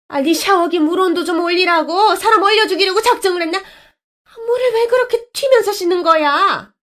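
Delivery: furious shower-room shout; bully bellowing a rebuke; angry scolding. Acted. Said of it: "호통을 치더군요".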